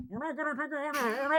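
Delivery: hushed, indistinct far-off reassurance; muffled, distant voice. Retold or imitated. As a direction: imitate a voice barely heard through ringing ears. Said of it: "And you hear her".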